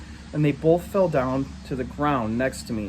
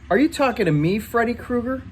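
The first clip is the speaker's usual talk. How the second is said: high, louder mocking challenge; sneering bully; 9 semitones higher, 3 dB louder than usual. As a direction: taunt him as a monster.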